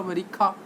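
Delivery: "अमेरिका" ending in aspirated "kha"; altered pronunciation. Shown as a demonstration. Teacher repeating the different foreign way, "अमेरिका" with breath on k. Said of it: "हर word को अलग अलग".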